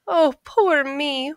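high, slow, drawn-out wail. A narrator's reading. Jamie wailing to himself about starving, high slow moan. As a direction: moan it like a lazy man in self-pity. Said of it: "wailed Jamie".

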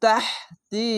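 তাহতি with a breathy throat h pushed out first; tajweed pronunciation. Shown as a demonstration. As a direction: intone তাহতি, the middle h clearly voiced from the throat. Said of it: "হা, তো এরকম হবে".

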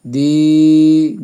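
"দি" held long on a steady note; tarikan satu alif. Shown as a demonstration. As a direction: stretch the vowel of "দি". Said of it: "এক আলিফ".

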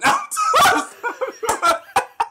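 high-pitched helpless laughing; real breathless belly laugh; laughter. Real, not acted. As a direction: crack up completely. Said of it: "I was just dying".

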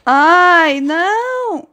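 high, drawn-out wail; loud start, rising high in frustration; dismayed cry. Real, not acted. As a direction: wail "ai, não" as it goes wrong. Fails again in the race.

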